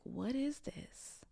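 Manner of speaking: hushed, slow, lost whisper; tearful whisper. Retold or imitated. Retelling her own bewildered tears in a whisper. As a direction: breathe it out like a bewildered child, barely voiced. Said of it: "sad and crying because I'm like".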